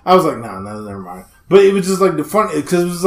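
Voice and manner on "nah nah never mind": much softer, lower, backing off under the breath; sheepish retreat. Retold or imitated. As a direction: drop it to a quiet mumble, giving up. Retold backing out of conversation.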